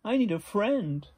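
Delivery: high, loud echoed plea; plaintive storybook call back; lonely echo voice. A narrator's reading. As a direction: answer as the echo, calling over water. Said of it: "said the voice from across the pond".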